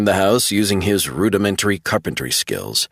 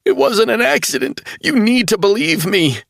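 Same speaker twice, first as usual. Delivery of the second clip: much higher, urgent pleading; desperate insistence; 3 dB louder than usual. A narrator's reading. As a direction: make him believe you. Travell insisting.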